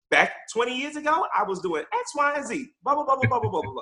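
high-pitched mock of his mother reminding them; imitating mom. Son mimicking his mother's lecture, high mock voice. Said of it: "she's always there to remind us".

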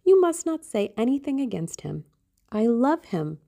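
high, earnest protest; exclaiming. A narrator's reading. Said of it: "she exclaimed".

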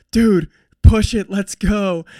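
loud high yell to push on; urgent shout to a tiring teammate; shouted encouragement. Retold or imitated. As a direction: yell at a runner to speed up. Teammate shouting encouragement mid-race.

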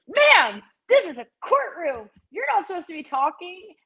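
mock the self-important officer; officer scolding a woman in the courtroom; mocking prissy officer.

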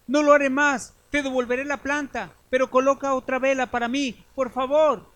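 high, frantic plea; desperate begging. Acted. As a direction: plead for another candle. Godson begs Death for life.

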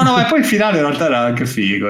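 normal talking voice

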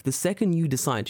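usual speaking voice

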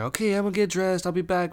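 put-on high, quick girl voice; put-on high-pitched voice, rattled off; imitated girl. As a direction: mimic the girl chirping a quick announcement. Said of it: "she's like".

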